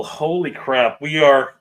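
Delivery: real delight at the total; excited exclamation. Real, not acted. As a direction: let the surprise burst out. Reacting to the funding total.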